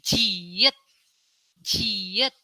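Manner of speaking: jiet said clearly with a rise; letter name demo. Shown as a demonstration. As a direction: model jiet twice. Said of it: "grup J".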